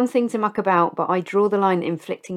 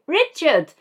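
hammed-up wail, much higher and louder; pleading for Richard to come home, overdone; mock anguished call; 12 semitones higher, 6 dB louder than usual. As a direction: call out like a jilted lover from a moving car. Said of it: "We'd go".

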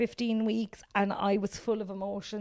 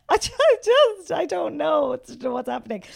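panicked new mother; 6 semitones higher, 8 dB louder than usual. Retold or imitated. New mother at the hospital, overwhelmed and panicking. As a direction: sputter out helpless confusion.

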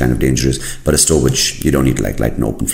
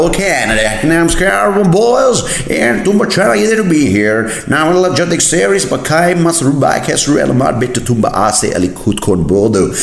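high, hammed-up hype-man Kannada; over-the-top swaggering announcer in Kannada. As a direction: work the crowd in showy, high-pitched Kannada. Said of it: "imagine if people start speaking Kannada like that".

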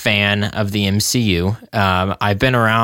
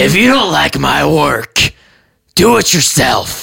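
angry; 3 semitones higher, 5 dB louder than usual. Acted. Mechanic snaps at complaining customer.

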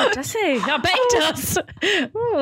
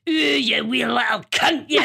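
mock Scottish swearing; Scottish accent; 2 semitones lower than usual. Shown as a demonstration. Showing which accent swears best, Scottish accent. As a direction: swear like a Scot. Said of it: "Is it Scottish?".

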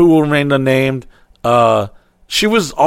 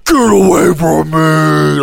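slow, drawn-out imitated shriek; a hammed-up frantic yell; retelling the crashed girl screaming at neighbors, mock yell; 2 semitones higher, 4 dB louder, slower than usual. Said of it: "she's, like, screaming".

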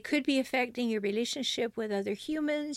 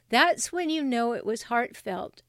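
ordinary talk, nothing marked

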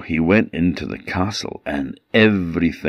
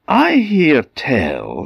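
high, slow, sly purr; sly cat voice; 9 semitones higher, 4 dB louder, much slower than usual. A narrator's reading. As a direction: draw it out slyly like a cat. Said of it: "said Puss".

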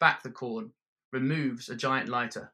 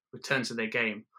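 usual speaking voice

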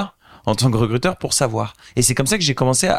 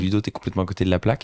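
rattled off fast to himself; a quick, rushed aside to yourself; fast self-talk; 3 semitones lower, much faster than usual. Retold or imitated. At the gala, telling himself he is underdressed, rushed.

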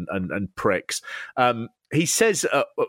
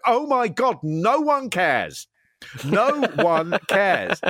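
slow, higher, fed-up drawl imitating Kevin Owens; mock exasperation; 8 semitones higher, much slower than usual. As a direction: drag it out like he's sick of it all. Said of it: "Kevin Owens just going".

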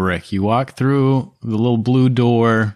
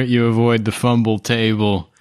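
ordinary talk

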